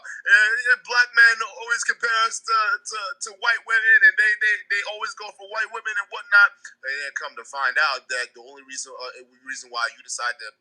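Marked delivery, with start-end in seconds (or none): 0.0-6.5 high, loud mock-complaining voice. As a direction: imitate her griping, pitched up and louder. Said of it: "she was spitting that same bullshit, talking about".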